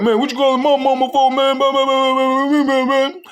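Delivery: very high, loud whiny near-sob; mock a kid whining on the verge of tears; high-pitched whimpering complaint about his mama; about to cry. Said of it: "Sound like he was finna cry".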